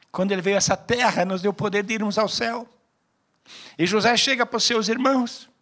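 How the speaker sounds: loud, high preacher's call to the church; fiery preaching. Acted. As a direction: drive each phrase home from the pulpit. Preaching at full force.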